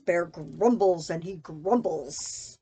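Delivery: usual speaking voice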